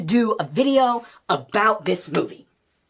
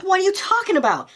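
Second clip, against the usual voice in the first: higher-pitched mock disbelief; can't believe what you just saw; incredulous; 10 semitones higher than usual. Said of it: "I'm like".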